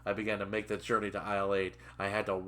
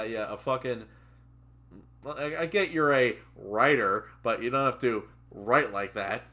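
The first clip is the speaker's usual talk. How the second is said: ordinary delivery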